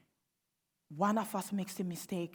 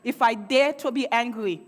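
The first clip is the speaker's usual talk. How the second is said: ordinary talk, nothing marked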